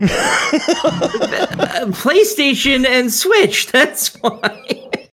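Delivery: high, slow words through laughter; giggly, high-pitched defence; laughing excuse. Real, not acted. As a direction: laugh your way through the explanation. Laughing excuse after being teased.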